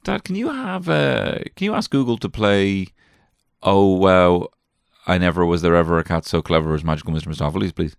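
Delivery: put-on child asking. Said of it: "he says".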